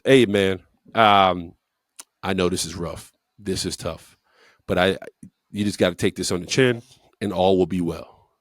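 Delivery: low, softened voice of a sympathetic veteran; retold consoling. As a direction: gently break bad news, voice dropped low. Offensive line head consoles rookie.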